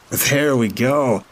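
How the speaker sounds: slow, sing-out delight at getting what he wanted; rejoicing. A narrator's reading. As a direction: stretch it out in triumph. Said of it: "Todd rejoiced".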